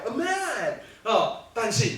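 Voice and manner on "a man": 很慢地、惊讶地拉长"A man"; 像吓了一跳一样说出来; 意外惊讶. Shown as a demonstration. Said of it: "足意外". (用非常意外的口气慢慢讲"A man")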